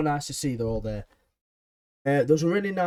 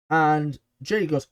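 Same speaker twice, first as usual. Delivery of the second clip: ordinary delivery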